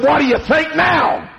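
high, shouted challenge; bully's taunt. Retold or imitated. Bully turns back to the beaten kid, taunting. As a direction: throw it out as a triumphant taunt.

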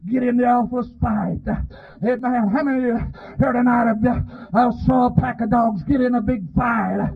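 normal talking voice

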